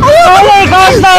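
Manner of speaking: shocked. Real, not acted. Scared on the dune ride.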